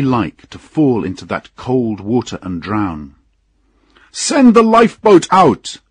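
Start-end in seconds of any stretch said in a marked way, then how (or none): 4.1-5.8 very high, loud urgent command; shouted order. A narrator's reading. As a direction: yell it out like an emergency order. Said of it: "came the order".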